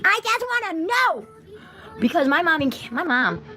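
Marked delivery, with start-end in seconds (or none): 0.0-1.3 higher and slow, stretching every word; pleading into the air, pulling each word long; drawn-out high plea. Real, not acted. Streamer asks why nobody helps her, stretched high and slow.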